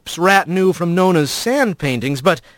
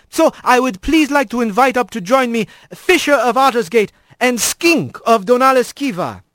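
higher, grand ceremonial speech; squirrel priest voice; 6 semitones higher than usual. A narrator's reading. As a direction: proclaim like a proud old priest. Alphonse invites guests up.

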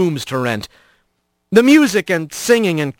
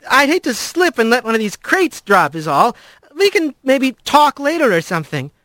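higher, embarrassed excuse; flustered; 6 semitones higher than usual. A narrator's reading. Rat worried about dropping crates, flustered. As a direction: explain awkwardly in a higher voice. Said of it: "Rat could feel his ears blushing".

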